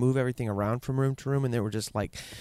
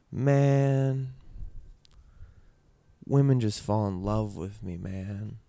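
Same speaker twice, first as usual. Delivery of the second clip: wistful, drawn-out quote with a trailing end; a touch of sadness in the retold boast; sad imitation; 3 semitones lower, slower than usual. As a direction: imitate dad's line with a sad, mournful color. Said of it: "I made that sound sad 'cause I got sad".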